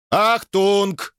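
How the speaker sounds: high-pitched frantic alarm cry; frantic yell. A narrator's reading. Robot yelling a warning, frantic high cry. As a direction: yell it high, on one held note. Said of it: "истошно заорал сэр Найджел".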